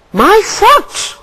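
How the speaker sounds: high, pained wail; pained cry. A narrator's reading. Gull wailing about his foot, high and pained. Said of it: "cried Gull".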